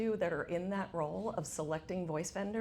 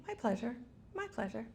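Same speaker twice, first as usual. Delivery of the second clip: higher, repeated rote cashier line; robotic; 5 semitones higher, 4 dB quieter than usual. Retold or imitated. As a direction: lightly imitate an automated checkout reply. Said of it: "It's this very robotic".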